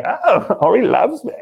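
high, giggly imitation of a touched wife; playful mock swooning; delighted wife. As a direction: gush it as the wife who feels adored. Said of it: "she'd be like".

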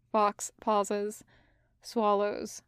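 ordinary delivery

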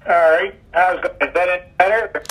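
words dropping out on a bad line; choppy cut off endings. Real, not acted. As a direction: call audio breaking up. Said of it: "Still cutting out".

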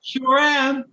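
high, sing-out answer at normal volume; mock-chipper high reply; chirpy retold reply. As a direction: pitch it way up, playing yourself. Acted chirpy reply to clerk.

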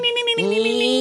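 squeaky high-pitched pop idol voice; high anime voice. Shown as a demonstration. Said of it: "it's like".

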